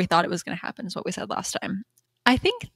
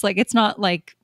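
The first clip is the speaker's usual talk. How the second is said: usual speaking voice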